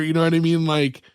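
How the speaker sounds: ordinary delivery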